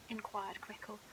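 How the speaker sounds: ordinary delivery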